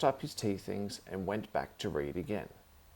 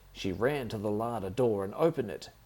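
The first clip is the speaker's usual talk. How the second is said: ordinary delivery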